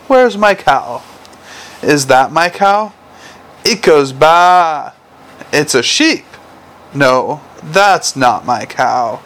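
slow, drawn-out picture-book voice; playful bedtime-story delivery; reading to children. A narrator's reading. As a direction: read it to a toddler with stretched animal noises.

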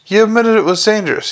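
high, rapid accusation; screamed. A narrator's reading. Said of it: "he screamed".

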